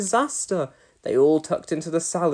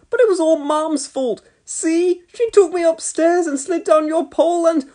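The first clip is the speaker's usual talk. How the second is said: put-on whiny boy; 12 semitones higher, 4 dB louder than usual. A narrator's reading. Boy blames mum.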